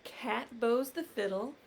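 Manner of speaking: plain reading voice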